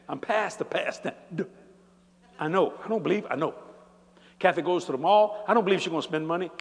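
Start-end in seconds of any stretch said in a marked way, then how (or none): none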